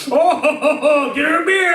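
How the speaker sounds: high, laughing barfly call; hammed-up guy ordering her a drink; mock pickup line. Man in a bar offering a woman a beer, high and laughing.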